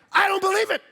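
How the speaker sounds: high, loud incredulous scoff; disbelieving. Retold or imitated. Husband scoffing at the driver who cut in, incredulous. Retold road rage disbelief.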